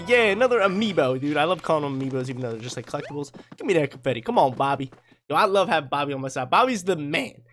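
normal talking voice

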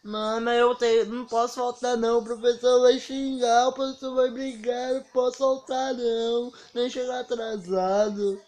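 high, loud whiny wail; imitated tearful whining, stretched at the end; mock crying. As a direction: plead with mom like a bawling kid. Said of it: "Aí eu comecei a chorar".

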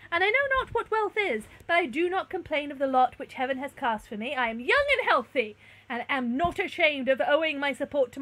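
loud, earnest declamation; proud young stranger. A narrator's reading. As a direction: plead with dignity. Stranger declines the offer.

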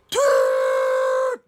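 loud high party-horn toot; noisemaker toot. Shown as a demonstration. Storyteller showing kids the noisemaker's sound, loud toot. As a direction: blast it like a noisemaker.

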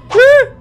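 sudden high loud scream; startled scream. Real, not acted. Player startled by the monster, sudden high scream. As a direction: yelp in real fright.

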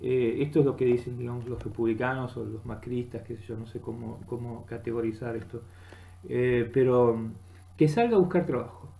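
normal talking voice

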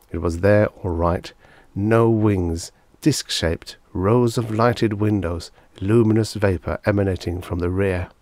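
plain reading voice

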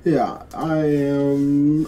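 ordinary talk

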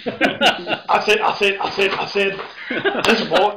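put-on loud Foghorn-style Southern accent; loud hammed-up Southern bluster; hammy Southern. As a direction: bluster it like a cartoon rooster.

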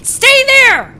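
high, loud, falling yell. Real, not acted. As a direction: yell the command at it. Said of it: "I'm, like, yelling at it".

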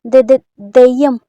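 loud, stammering cry of fright; terrified stammer. Acted. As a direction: stumble over the word in terror. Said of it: "అలా అరుస్తున్నారు?".

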